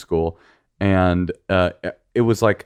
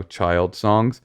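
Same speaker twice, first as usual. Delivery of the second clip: ordinary talk, nothing marked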